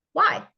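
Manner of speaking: sharp, high, loud probing Why; pointed why. Shown as a demonstration. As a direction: fire the question Why at them. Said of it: "ask why up to five times".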